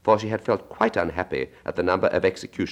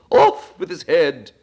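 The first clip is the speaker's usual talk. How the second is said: higher, louder, slow royal roar; Queen shouting; 9 semitones higher, 7 dB louder, slower than usual. Acted. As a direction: bark the sentence at the player.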